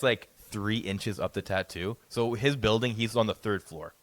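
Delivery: usual speaking voice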